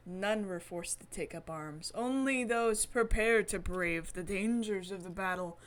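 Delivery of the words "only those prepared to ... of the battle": usual speaking voice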